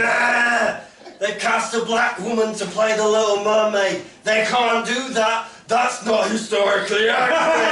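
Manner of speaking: high, loud mock outrage; online complainer. People complaining online about Little Mermaid casting, mock outrage. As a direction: whinge like an internet moaner. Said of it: "what they sound like when they complain online".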